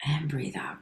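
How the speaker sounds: whispered exhale cue; soft whispered coaching; whispered breathing cue. Acted.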